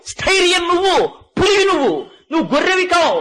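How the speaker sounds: preacher urging the cub that it is a tiger, not a sheep; preaching at full force. Acted. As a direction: build the list like a rousing sermon. Preacher rises to exhortation.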